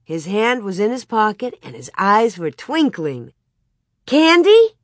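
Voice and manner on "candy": high, loud eager question; excited guess. A narrator's reading. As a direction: pipe up hopefully like children. Children guess at a surprise.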